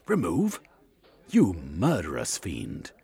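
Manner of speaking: loud outraged gentleman, last words slow; horrified Cornucope. A narrator's reading. Cornucope, hearing of the plot, outraged and loud. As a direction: recoil in shock at the plan.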